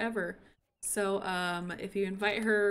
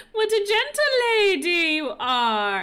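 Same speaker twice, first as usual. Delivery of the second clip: high, loud, laughing through tears; teary laughing coo; 7 semitones higher, 7 dB louder than usual. Real, not acted. Praising her hen, laughing through tears. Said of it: "I'm trying not to sniffle".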